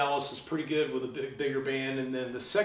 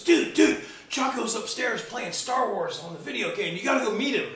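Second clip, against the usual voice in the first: excited calling out; 6 semitones higher than usual. Retold or imitated. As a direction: hurry them over, bursting with news. Buddy excitedly calling him over.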